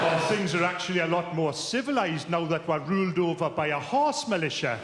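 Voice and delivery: a lightly voiced English accent; mock English accent. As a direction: do a posh English refugee's accent, played for laughs. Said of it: "That's a good accent".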